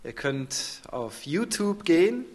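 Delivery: ordinary talk, nothing marked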